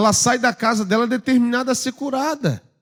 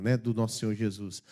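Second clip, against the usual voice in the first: ordinary talk, nothing marked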